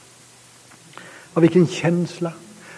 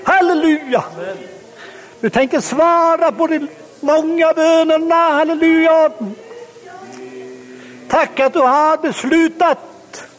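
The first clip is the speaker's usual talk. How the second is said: fervent prayer; 6 semitones higher than usual. Acted. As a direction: praise prayer building to a high, ringing pitch. Leads a closing prayer of praise.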